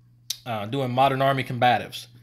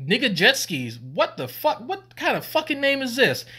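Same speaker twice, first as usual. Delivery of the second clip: high, incredulous retold questioning; rising pitch, playful disbelief; mock outrage; 11 semitones higher than usual. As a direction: act appalled by the recruit's name. Mock outrage at recruit's name.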